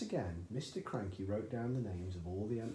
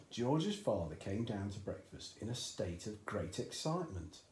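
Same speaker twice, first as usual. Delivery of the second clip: usual speaking voice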